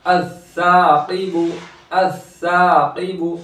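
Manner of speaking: अस्साक़िबु with सा held long; stretched सा. Shown as a demonstration. Teacher demonstrates the alif madda, सा in अस्साक़िबु held long. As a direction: draw out the आ after the doubled स in अस्साक़िबु. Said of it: "सा में खींचेंगे एक अलिफ".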